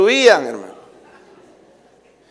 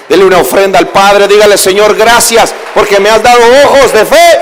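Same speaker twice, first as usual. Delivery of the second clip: louder preaching push; preaching call; 6 dB louder than usual. Acted. Preacher urging the congregation to give an offering, loud preaching.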